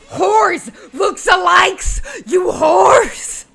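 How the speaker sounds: high, loud, furious yelling; shouted insult. Acted. Horseman spits at Y'shtola, then shouts insults. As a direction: hurl it out at the top of your voice. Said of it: "and then shout".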